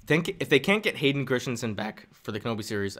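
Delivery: ordinary talk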